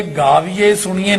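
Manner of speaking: ਗਾਵੀਏ, ਸੁਣੀਏ ending in a close e; mistaken e endings. Shown as a demonstration. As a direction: demonstrate ਰੱਖੀਏ with a plain e instead of ai. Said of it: "ਨਹੀਂ ਕਹਿਣਾ".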